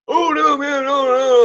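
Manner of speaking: put-on high squeaky scared kid pleading; mock whiny teen. To the dad offering a fair fight, high frightened pleading. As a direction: squeal it like a frightened teenager backing down.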